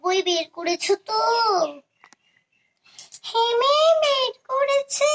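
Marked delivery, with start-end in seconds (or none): 3.3-5.1 little pupil's raised, high reply. Pretend. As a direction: chirp a high, eager yes to the teacher. Pupil answers pretend teacher.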